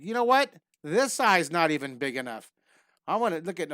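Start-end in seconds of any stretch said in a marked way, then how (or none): 0.0-2.5 louder, hammed-up frustration over a drawing; mock exasperation. Teacher to students fussing over the eye, mock exasperated. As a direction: play up being fed up, jokingly.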